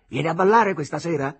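high, loud parting call; calling after someone. A narrator's reading. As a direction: shout it after a departing friend. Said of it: "gli gridò dietro l'altro". (richiamo gridato alle spalle)

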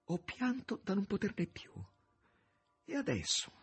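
high, loud, anguished woman's voice; Margherita pleading. A narrator's reading. Margherita reproaching the master who sends her away, high and anguished. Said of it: "sussurrava Margherita".

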